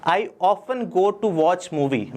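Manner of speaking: drawn-out sample sentence for learners; slow example sentence. Shown as a demonstration. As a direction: say it slowly, word by word. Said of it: "example sentence".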